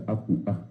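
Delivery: "Apu A" said with an oral, non-nasal "A"; without nasality. Shown as a demonstration. Teacher contrasting a Guaraní word, oral A with no nasality. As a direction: keep the "A" in "Apu A" clean, not nasal. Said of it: "Pero sin la nasalidad".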